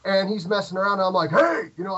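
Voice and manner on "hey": loud, high-pitched shout; sudden loud "hey" yell. Retold or imitated. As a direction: yell it out to challenge someone. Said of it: "I'm, like, yelling".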